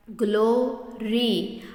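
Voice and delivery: Glory in two slow beats, "glo - ree"; split into syllables. Shown as a demonstration. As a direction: break glory in two with a clear gap. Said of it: "दो part में जब आप बोल रहे हैं". (Glory को दो हिस्सों में तोड़कर, धीरे)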